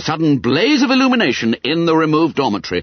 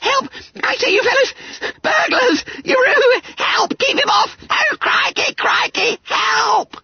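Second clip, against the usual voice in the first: very high-pitched, panicked squeal for help; yelling at the top of his voice. A narrator's reading. Fat schoolboy fleeing a burglar into the dormitory, yelling frantically. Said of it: "yelling at the top of his voice".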